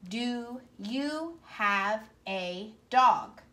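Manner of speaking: slow, word-by-word read-along; slow pointing read. Shown as a demonstration. Teacher modelling touch-reading for kindergartners. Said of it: "touch under each word as you read".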